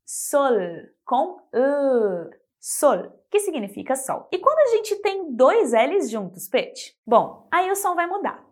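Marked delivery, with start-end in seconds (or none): 0.0-3.2 sol with a clear final L; sol, tongue up for the L; sol, L not U; Spanish sol. Shown as a demonstration. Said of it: "a palavra sol".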